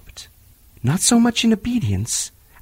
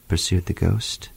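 ordinary delivery

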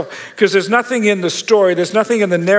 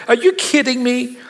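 higher, mock disbelief; as if you can't believe your ears; incredulous; 6 semitones higher than usual. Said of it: "and I wanna go".

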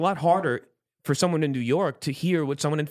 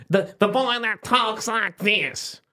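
slow, higher imitation of an old woman; a fancy old lady asking sweetly, pitched up and slow; 9 semitones higher, slower than usual. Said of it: "What she said".

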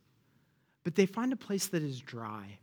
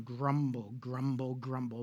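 fading grumble; 5 semitones lower, 5 dB quieter than usual. Shown as a demonstration. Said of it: "repeated word fading".

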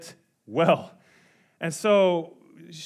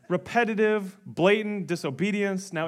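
ordinary delivery

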